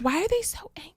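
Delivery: high startled whisper; whispered question. Retold or imitated. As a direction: breathe it out in disbelief. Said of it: "I was like".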